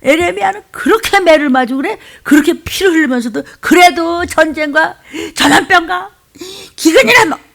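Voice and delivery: high, tearful pleading; preacher weeping over the prophet; crying out. Real, not acted. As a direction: cry through the words. Preacher pleading over Jeremiah's suffering.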